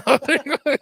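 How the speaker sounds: high, loud burst through laughter; laughing. Real, not acted. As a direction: crack up while talking. Breaks into laughter mid camera fix.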